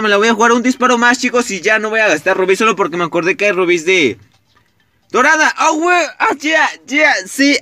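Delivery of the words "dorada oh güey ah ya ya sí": excited outburst. Real, not acted. Rare gold pull appears.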